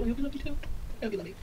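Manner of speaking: high, soft and pleading. Real, not acted. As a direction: coax them sweetly. Pleading softly to viewers.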